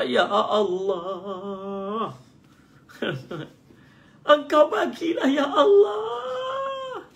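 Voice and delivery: a hammed-up high wail, dragging out ya Allah; mock a worshipper howling at God; wailing shouted prayer. Shown as a demonstration. Preacher mocks a shouting worshipper, high wailing cry. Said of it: "bukannya menjerit-jerit".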